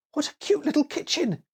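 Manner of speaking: put-on high girlish exclamation; excited little girl. A narrator's reading. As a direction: squeal with delight as a little girl. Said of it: "exclaimed Annie".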